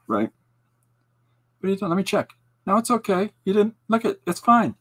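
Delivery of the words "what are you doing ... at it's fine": mock fussing. Comforting the ground, not son.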